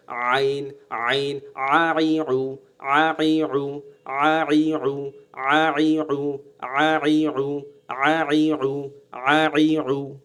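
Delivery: constrict the throat on every vowel of আইউ; throat pressed. Shown as a demonstration. Teacher drilling the letter ain, throat-squeezed sound. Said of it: "একটু চাপ দেবেন".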